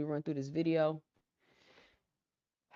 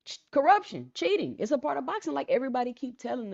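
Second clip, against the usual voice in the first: rising indignation; 9 semitones higher, 5 dB louder than usual. Real, not acted. Listing boxing's ills, building up.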